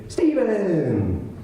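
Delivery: high, bright drawn-out call of the name; Chris Zarba's greeting. Retold or imitated. Friend answering the phone, a high cheerful greeting. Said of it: "he would say".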